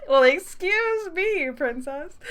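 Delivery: high, drawn-out, hammed-up quip; exaggerated cartoonish huff, very high; mock indignation. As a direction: stretch it out theatrically. Hammed-up quip echoing the character's line.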